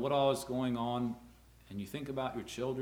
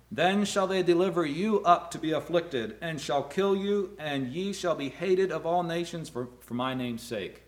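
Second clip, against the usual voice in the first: scripture reading; 4 semitones higher, 5 dB louder than usual. Acted. Quotes Jesus from Matthew.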